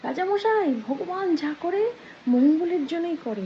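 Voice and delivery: high, drawn-out mock shout; storyteller's half-shouted, sing-out call; acted calling out. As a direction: call up from afar, stretching the words. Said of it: "ওখান থেকে চিৎকার করে বলছে".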